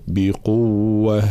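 very slow بقوة with a strong doubled waw; stressed doubled waw. Shown as a demonstration. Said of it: "بيان تشديد الواو".

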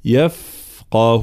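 very slow يفقه, light يف and heavy ق; careful tajweed articulation. Shown as a demonstration. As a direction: draw out the ف, then a full ق in يفقه. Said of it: "عندنا القاف مفخمة". («يفقه» بترقيق الياء وتفخيم القاف)

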